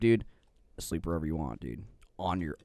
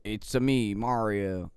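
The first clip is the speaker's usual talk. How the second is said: lightly Italian singsong It's a me; a hint of Mario's Italian bounce; Mario impression; much slower than usual. Asked to try an Italian accent, a light Mario voice. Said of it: "Uh, I can try one".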